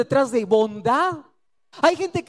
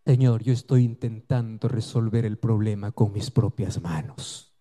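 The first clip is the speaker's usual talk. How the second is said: lightly voiced man replying; 13 semitones lower than usual. Man answers God, lower.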